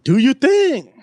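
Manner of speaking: sarcastic retort. Retold or imitated. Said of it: "I said".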